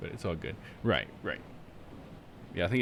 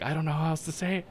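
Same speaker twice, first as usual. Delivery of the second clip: higher-pitched retold protest; exasperated retelling, voice pitched up; retold exasperation; 7 semitones higher than usual. Streamer recalling being corrected by chat, exasperated.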